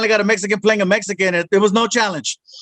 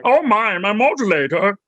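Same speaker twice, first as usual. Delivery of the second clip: cartoon alien impression, slightly raised pitch; Marvin the Martian; 3 semitones higher than usual. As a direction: put on a fussy cartoon Martian voice. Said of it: "You want Marvin the Martian?".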